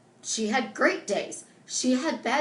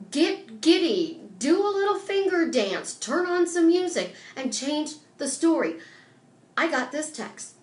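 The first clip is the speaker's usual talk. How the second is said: ordinary delivery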